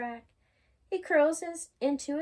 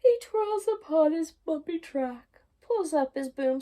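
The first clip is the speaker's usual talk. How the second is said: excited; 5 semitones higher, 8 dB louder than usual. Acted. Lively excited reading.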